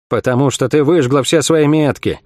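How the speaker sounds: ordinary delivery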